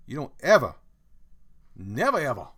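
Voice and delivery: loud hammed-up telling off; mock scolding. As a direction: scold like a pretend angry parent.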